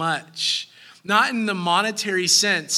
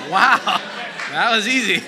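louder, laughing wow; laughing surprise; 4 dB louder than usual. Retold or imitated. Tourist laughing at a vendor's quick discount, amused. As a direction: chuckle through it, pleased and amazed. Amused shopper after easy bargain.

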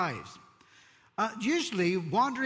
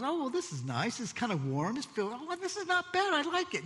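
high, happy little frog voice, lounging; hammed-up frog sinking into warm water; contented frog; 7 semitones higher than usual. Preacher voicing a frog relaxing in the heating pot, high and pleased. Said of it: "And the frog goes".

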